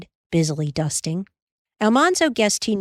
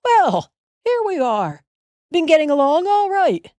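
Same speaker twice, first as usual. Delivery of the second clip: a happy father home from a trip; a father greeting his children cheerfully; 11 semitones higher, 4 dB louder than usual. A narrator's reading. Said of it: "Father said cheerfully".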